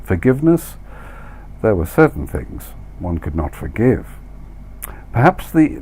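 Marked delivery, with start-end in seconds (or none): none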